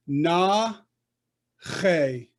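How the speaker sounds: "na che" with a pause between; teacher sounding out "na ... che"; syllable-by-syllable demo. Shown as a demonstration. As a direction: hold "na", then a throaty "che". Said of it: "So this one would be".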